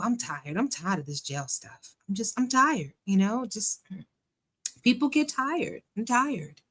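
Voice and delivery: soft, weary mimicked voice; hushed, half-hearted complaint in a put-on voice; Shirley. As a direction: lightly imitate someone tired and fed up. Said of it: "That's my best Shirley voice".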